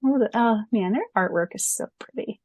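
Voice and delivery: ordinary delivery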